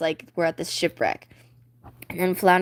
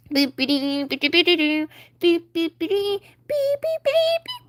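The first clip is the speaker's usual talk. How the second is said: kazoo beeps climbing ever higher; kazoo fanfare; 9 semitones higher than usual. Acted. Kazoos play Sebastian's fanfare, beeps rising high. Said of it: "kazoos play".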